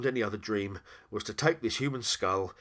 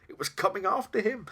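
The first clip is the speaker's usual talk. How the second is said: alarm; 13 semitones higher, 5 dB louder than usual. A narrator's reading. Narrator voices Jack's alarm in the chase.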